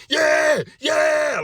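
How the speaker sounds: high, loud yeah, yeah cheer; celebrating shout. Retold or imitated. Patient cheers at the TV in a loud high shout. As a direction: whoop at the TV like a touchdown. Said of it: "shouting at the T V, celebrating".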